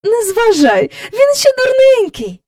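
high, loud mother's voice; dismissive reassurance. A narrator's reading. Missis Banks telling husband to ignore Michael, high-voiced dismissal. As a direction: brush off the child's remark.

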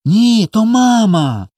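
high, slow, drawn-out insistence; insisting. A narrator's reading. Little boy assures his brother it is their mother, insisting. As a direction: insist like a small child. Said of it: "завіряв Коля".

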